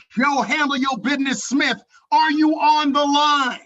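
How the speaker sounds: big stretched-out shouted call; hype announcer shout. Real, not acted. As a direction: call the trainer up like a ring announcer. Hype introduction of next trainer.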